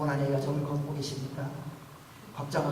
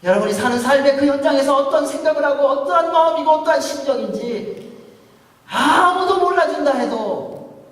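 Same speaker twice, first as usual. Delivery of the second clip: loud, high, rousing sermon climax; full-force preaching; 9 semitones higher, 10 dB louder than usual. Acted. Pastor proclaiming God's presence to the congregation, loud and rousing. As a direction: proclaim it to the back row.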